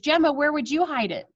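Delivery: usual speaking voice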